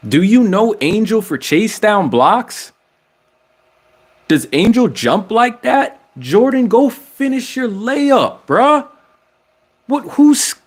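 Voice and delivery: high, incredulous pleading; squeaky-high exasperation, stretched out for effect; exasperated disbelief. Acted. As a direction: argue with the player, pitch climbing way up. Exasperated appeal to player over missed layup.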